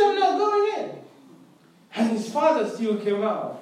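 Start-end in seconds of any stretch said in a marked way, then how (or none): 0.0-0.9 high-pitched, acted refusal; hammed-up stubborn refusal; tantrum refusal. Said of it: "he refused to go in".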